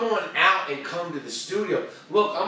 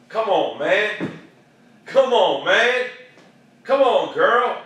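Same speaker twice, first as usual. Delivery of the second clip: louder, drawn-out, dipping and rising plea; urging exhortation; 3 semitones higher, 7 dB louder, slower than usual. Real, not acted. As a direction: lean hard on each COME ON. Urging listeners to step up.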